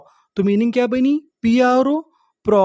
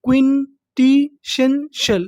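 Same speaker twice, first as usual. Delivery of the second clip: क्विंटिशेंशल drawn out slowly, syllable by syllable; 3 semitones higher, much slower than usual. Shown as a demonstration. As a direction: stretch क्विंटिशेंशल slowly for students. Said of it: "तो meaning पूरी". (क्विंटिशेंशल को धीरे धीरे तोड़कर बोलिए)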